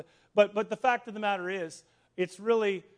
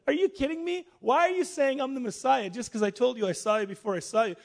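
higher, louder acted disbelief; mock incredulity; 5 semitones higher, 6 dB louder than usual. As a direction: question him in playful astonishment. Jesus acted answering in mock disbelief.

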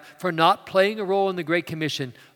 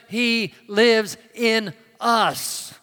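slow, weighty; 4 semitones higher, 3 dB louder, much slower than usual. Acted. Climax line drawn out slowly.

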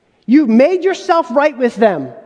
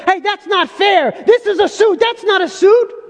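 high, protesting know-it-all voice; mock objector; 8 semitones higher than usual. Pastor mocking a critic's protest, high and indignant. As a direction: play the nitpicker who cries foul. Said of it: "And you can't say".

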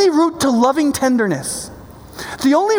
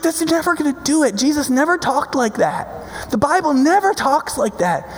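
normal talking voice

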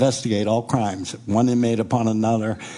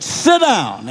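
firm command; 8 semitones higher, 4 dB louder than usual. Retold or imitated. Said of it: "and I said".

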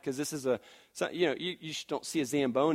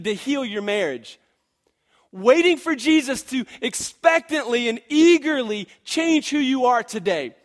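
loud, high, urgent preaching; fervent preaching; 7 semitones higher, 8 dB louder than usual. Acted. Pastor urging the congregation to wait eagerly, loud preaching. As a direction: lift the room, loud and high.

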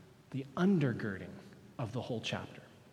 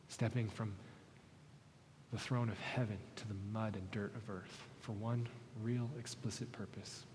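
prayer; 4 semitones lower, 8 dB quieter than usual. Real, not acted. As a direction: pray quietly and sincerely for the church. Pastor leads closing prayer.

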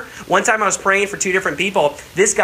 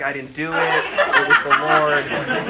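laughing; slower than usual. Retold or imitated. Joking denial, laughing.

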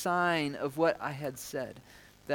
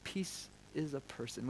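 soft repetition; 3 semitones lower, 13 dB quieter than usual. Shown as a demonstration. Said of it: "hold on to this".

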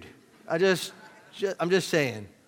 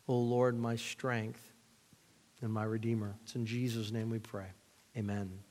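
hushed congregational prayer, trailing softer; soft, lower voice addressed to God; sincere prayer; 3 semitones lower, 5 dB quieter than usual. Real, not acted. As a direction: lead them quietly in prayer.

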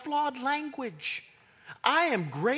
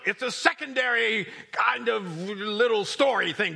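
loud, hammered-out imitation of a dogmatist; mock a stickler laying down the law loudly; insistent purist; 3 semitones higher, 6 dB louder than usual. Preacher imitating Bible purists who reject other versions, insistent.

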